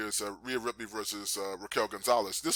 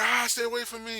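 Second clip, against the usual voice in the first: high-pitched panicked plea; mock terror; 11 semitones higher, 3 dB louder than usual. Imitating a wrestler chased by graveyard zombies, mock terror. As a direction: yelp it in pretend fright.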